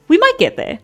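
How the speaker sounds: "We might get there" jumps way up high, playful squeak; squeaky high take. Shown as a demonstration. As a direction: chirp it high as a joke. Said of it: "teasing the chat about reaching Cyclops".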